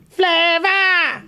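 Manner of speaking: high, nasal show tagline; imitated nasal TV jingle shout; nasal style. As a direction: draw it out through the nose. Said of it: "textbooks nasal style".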